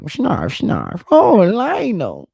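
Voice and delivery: high, slow Snarf impression; squeaky cartoon Snarf, drawn out. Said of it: "he sounds like".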